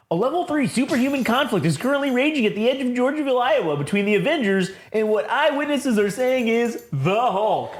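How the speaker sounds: high, fast newscast delivery; TV news reporter. Acted. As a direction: rattle off a breaking-news bulletin. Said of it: "then you just see on the news".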